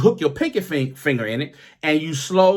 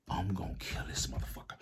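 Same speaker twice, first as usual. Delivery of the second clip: seething whisper; 7 semitones lower, 18 dB quieter than usual. Retold or imitated. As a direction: hiss the rage under your breath. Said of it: "I was so goddamn mad".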